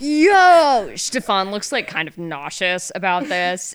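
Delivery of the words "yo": loud, high hype yell; excited shout. Acted. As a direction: yell it hyped. NPC greets excitedly.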